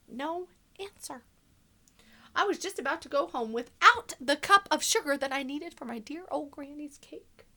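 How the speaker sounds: sly wolf narrator, hushed then punchy; storybook reading. A narrator's reading. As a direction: tell it to children as the wolf, confiding and playful. Continues the wolf's story.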